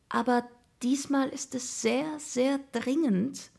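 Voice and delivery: high-pitched, drawn-out innocent plea; innocent pleading. A narrator's reading. Jakob talking his way past an annoyed mother, innocently pleading. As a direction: plead sweetly, stretching the words. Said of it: "erklärte Jakob mit seinem berühmten, unschuldigen Augenaufschlag".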